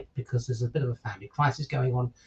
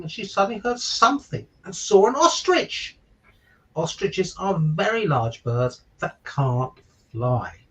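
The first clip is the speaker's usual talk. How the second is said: put-on bright, lilting picture-book narration for children; story reading; 3 dB louder, slower than usual. A narrator's reading. Father reading a giraffe story to listeners, lilting storybook narration. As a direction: read it like bedtime story time for little ones.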